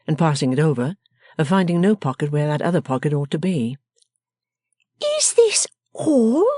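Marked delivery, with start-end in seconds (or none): 5.2-6.5 high, slow, drawn-out demand; sharp demand. A narrator's reading. Miss Wren counting his money, a high, slow demand. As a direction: demand it slowly in a high scolding voice. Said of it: "demanded the person of the house".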